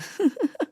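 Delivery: brief, soft laugh; short chuckle. Acted. Villager to policeman about his own ill omen, short chuckle.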